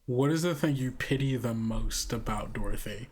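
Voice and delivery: ordinary delivery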